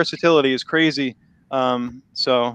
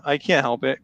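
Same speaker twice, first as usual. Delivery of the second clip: usual speaking voice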